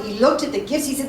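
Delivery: ordinary delivery, nothing marked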